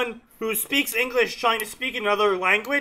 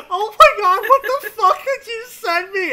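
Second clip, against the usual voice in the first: high, loud shout through laughter; shocked and cracking up; laughing shout; 11 semitones higher, 7 dB louder than usual. Real, not acted. Shocked by a sent image.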